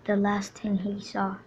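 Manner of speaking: soft, mournful storyteller near a whisper; hushed tragic narration. A narrator's reading. Narrator telling the hero's death, hushed and mournful. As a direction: mourn the hero as you tell it.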